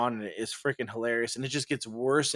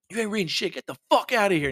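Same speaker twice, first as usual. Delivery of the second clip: angry homeowner; 7 semitones higher, faster than usual. Retold or imitated. Homeowner keeps threatening.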